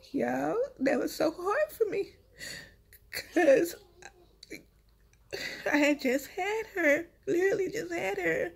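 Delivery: fighting tears as it comes back; crying. Real, not acted. Mother recalling her newborn taken away, voice breaking with tears. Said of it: "I'm sorry I be crying".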